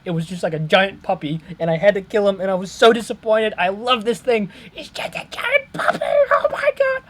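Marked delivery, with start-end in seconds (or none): 4.7-7.0 very high, breathless fan squeal; giddy squeal. Real, not acted. Fan gushing over the monster, very high squeal. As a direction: squeak it out, overwhelmed with cuteness.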